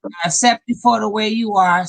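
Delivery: normal talking voice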